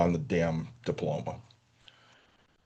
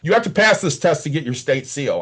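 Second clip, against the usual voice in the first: higher, louder mock coach's lecture; retold scolding; 5 semitones higher, 5 dB louder than usual. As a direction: lay down the law as the coach. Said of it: "Are you yelling at him too".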